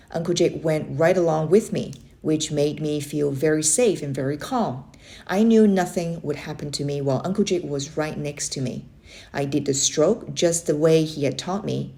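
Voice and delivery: ordinary delivery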